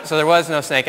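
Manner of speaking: normal talking voice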